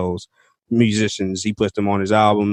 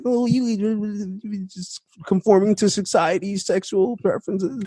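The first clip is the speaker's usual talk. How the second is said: high-pitched mock indignation; mocking quote; 10 semitones higher than usual. Host mimicking people mad at a singer's weight loss, mocking. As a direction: parody a scolding critic, voice pitched up.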